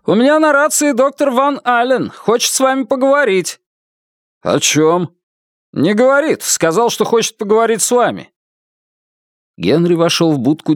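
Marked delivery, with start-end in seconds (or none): 0.0-8.3 dispatcher voice. A narrator's reading. Dispatcher reports radio call.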